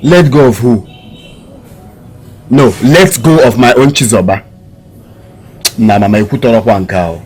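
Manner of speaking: loud, incredulous echo of the advice; forceful, wounded objection to mother; indignant protest. Acted. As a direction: throw it back at her, outraged. Son outraged at mother's advice.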